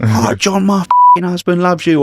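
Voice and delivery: high mock-excited woman; lightly voiced gushing wife; woman fan. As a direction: squeal it like a fawning fan.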